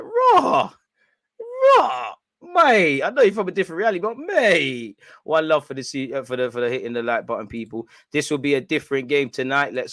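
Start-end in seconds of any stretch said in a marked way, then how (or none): 0.0-5.0 loud, high exclamations of disbelief; mock disbelief. Fan re-enacting his answer to a mate, hammed-up disbelief. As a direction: protest at a mate in an exaggerated, raised voice.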